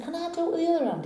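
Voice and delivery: cowboy's echo. Acted. Cowboy repeats barman's challenge, quick echo.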